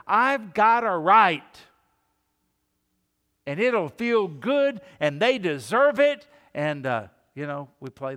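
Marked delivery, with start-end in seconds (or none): 0.1-6.3 louder, higher, slow self-righteous claim; hammed-up indignant outburst; mock indignation. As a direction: puff up with mock entitlement. Voicing self-righteous anger.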